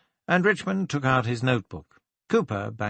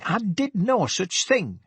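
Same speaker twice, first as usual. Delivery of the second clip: high, slow, indignant; man insulted by the question, high indignant refusal; outraged denial; 9 semitones higher than usual. Acted. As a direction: spell out each word in outrage. Cooper denies affair, getting up.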